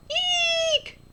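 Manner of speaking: very high squealing "Eek"; shrill mock fright squeal; excited squeal. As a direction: squeal like you just saw a snake. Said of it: "and be really excited".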